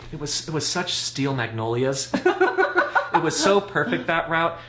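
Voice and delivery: talking through laughter; amused laughing. Real, not acted. Teller relives the family drama, laughing. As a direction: laugh at the memory as you tell it.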